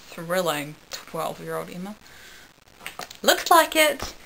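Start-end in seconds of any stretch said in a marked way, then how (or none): 3.2-4.1 high, chirpy reply; cheerful. Acted. Narrator answering Captain Jack about regenerating, cheerful. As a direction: answer brightly, pitched well up. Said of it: "I said cheerfully".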